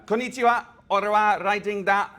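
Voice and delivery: high, loud, cheerful hello; excited greeting. Pretend. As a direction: greet the crowd brightly, up high. Jokey multilingual greetings to audience.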